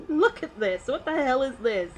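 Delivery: high, loud disbelieving outburst; loud, high shock and amusement; incredulous. Real, not acted. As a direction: exclaim at something absurd. Reacting to furry wildlife ad.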